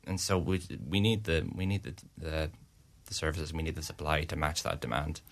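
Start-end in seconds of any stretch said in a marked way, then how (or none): none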